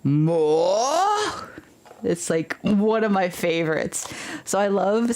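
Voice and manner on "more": stern overseer. Retold or imitated. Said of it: "he goes".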